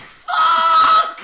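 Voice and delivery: high, loud laughing cry; laughing shout. Real, not acted. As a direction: burst out laughing and yell.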